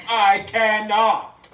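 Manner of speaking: exaggerated American accent. Mocking friend's accent attempt, slow loud extra-American accent. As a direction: stretch it out in a big American drawl. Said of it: "extra American".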